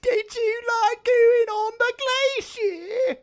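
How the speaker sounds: high, slow, wailing mock Cockney whine. Imagining the film in Cockney, wailing mock Cockney. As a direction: ham up a Cockney line from the film. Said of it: "imagine if this was, like, a Cockney film".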